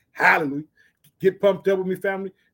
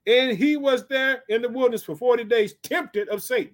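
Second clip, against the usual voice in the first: raised Bible-reading voice; scripture declamation; 6 semitones higher, 3 dB louder than usual. Acted. Preacher reads of Jesus tempted in the wilderness, declaiming. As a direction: proclaim the verse to the room.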